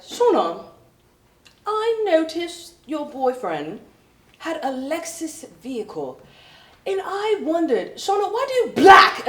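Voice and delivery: high, slow mock British boss; prissy British boss impression. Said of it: "he's like".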